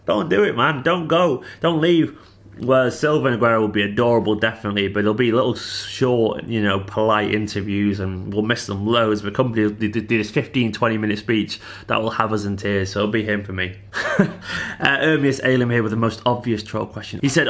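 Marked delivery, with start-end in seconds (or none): 0.1-2.2 raised, pleading plea; mock pleading to stay. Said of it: "makes you be like". 13.9-14.7 short airy laugh; a quick amused exhale laugh; light laugh; breathy laugh. Real, not acted. Laughs at troll question.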